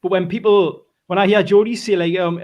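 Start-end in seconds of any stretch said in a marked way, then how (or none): none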